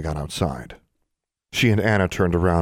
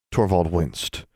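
plain reading voice

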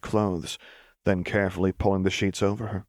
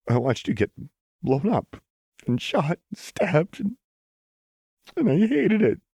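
from trembling to a high, loud cry; rising anguish; 11 semitones higher, 4 dB louder than usual. A narrator's reading. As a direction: let it build until it breaks. Lux breaks down, building to tears.

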